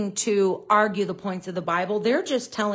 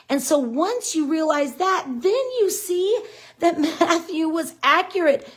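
excited; 7 semitones higher than usual. Real, not acted. Thrilled by her Bible discovery.

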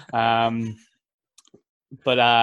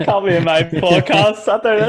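laughing; 8 semitones higher, 9 dB louder, slower than usual. Real, not acted.

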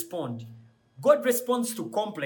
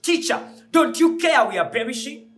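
louder, higher frightened cry; panicked plea; 7 semitones higher, 7 dB louder than usual. Retold or imitated. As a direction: beg like the boat is sinking. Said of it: "they woke him up with this voice like".

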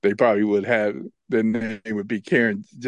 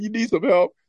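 higher voice calling across the street; imitating bus stop guy; 11 semitones higher than usual. Bus stop guy calls out to fallen narrator, mock concern. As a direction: call over, amused concern.

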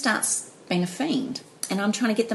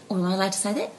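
normal talking voice

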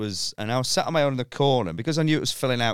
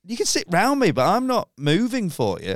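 higher, louder defiant reply; mock defiant, a touch louder; firm refusal; 6 semitones higher, 3 dB louder than usual. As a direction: stand your ground. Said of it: "And I went".

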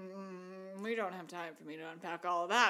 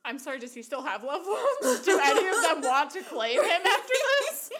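loud laughing, pitched up in disbelief; 6 semitones higher, 11 dB louder than usual. Real, not acted. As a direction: laugh through the words in amused outrage. Reacting to long last words.

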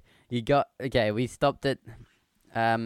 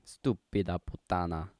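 Italian accent; 3 dB quieter than usual. Shown as a demonstration. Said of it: "what accents can you do".

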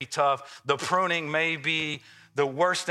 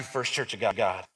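usual speaking voice

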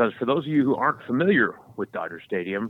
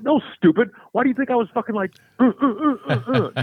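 high loud exasperated mock stammer; 12 semitones higher, 5 dB louder, faster than usual. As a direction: sputter at her in frustration. Mocking his own stammering.

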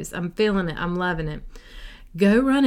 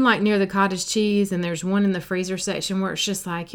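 normal talking voice

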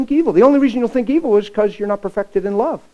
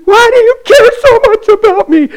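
high, loud, pleading voice; distraught cry; 11 dB louder than usual. Retold or imitated. Girl crying out to the pastor who prays for her. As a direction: ask it like you can't believe it.

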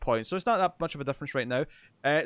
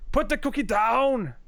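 put-on higher, slow Austrian accent; stern Austrian action hero, drawn out; slow attempted Austrian accent; Arnold Schwarzenegger impression; 8 semitones higher, 3 dB louder, slower than usual. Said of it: "are you doing the accent?".

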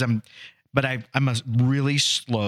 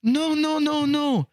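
put-on gentle Korean accent, amazed at losing; [name]'s Korean accent; slower than usual. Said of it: "doing an impression of somebody with an accent".

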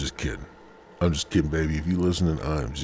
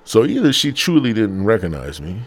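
usual speaking voice

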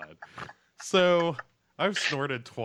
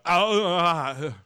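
mock savoring; 2 semitones higher, 5 dB louder than usual. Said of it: "you're like".

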